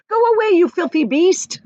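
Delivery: high loud shout. Acted. Friends shouting at the shark, high and loud. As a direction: yell it at the shark. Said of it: "they shouted".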